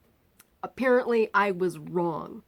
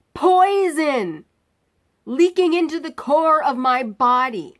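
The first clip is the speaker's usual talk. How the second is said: higher and louder, slow and building; retold outrage; 6 semitones higher, 6 dB louder, slower than usual. Patient confronting surgeon about ruptured appendix, retold outrage. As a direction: hammer each point slowly, furious.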